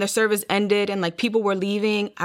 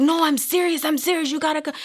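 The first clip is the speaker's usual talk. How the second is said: retold urgent insistence; 8 semitones higher, 3 dB louder than usual. Sister begging speaker to come to church, retold urgent insistence. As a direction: imitate an insistent sister, high-pitched.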